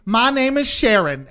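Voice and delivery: high, loud, drawn-out introduction; outgoing lady. Retold or imitated. Outgoing lady introducing herself to her seatmate, hammed-up friendliness. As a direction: stretch it out, big and sociable. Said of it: "She's like".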